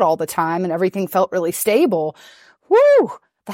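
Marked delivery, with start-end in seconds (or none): 2.7-3.1 a high, loud whoop of wild glee; excited whoop. Retold or imitated. The wild child bursting loose, a high loud whoop. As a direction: let out a loud party whoop.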